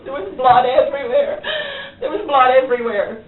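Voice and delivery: real tears breaking the words; crying. Real, not acted. Confession of killing, sobbing.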